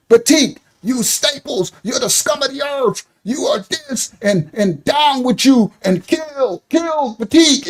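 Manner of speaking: high, softened mock heckling; pretend yell. Heckling a Staples worker across the street, high mock yell. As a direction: stretch it out like a distant protest chant. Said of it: "I'm saying, but I'm across the street".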